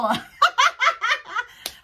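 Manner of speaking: high-pitched giggling burst; high laugh. Real, not acted. Vlogger amused by companion's question, high giggling. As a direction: laugh hard at your own impression. Laughing at her own impression.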